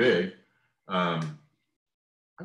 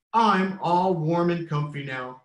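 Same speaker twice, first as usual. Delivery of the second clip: clucking contentedly; 7 semitones higher, 4 dB louder than usual. A narrator's reading. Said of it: "clucked the smaller chicken".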